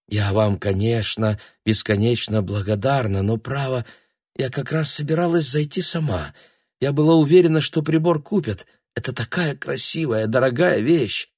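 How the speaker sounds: a hint of a fussy elderly lady; lightly voiced old woman.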